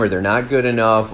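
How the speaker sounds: ordinary talk, nothing marked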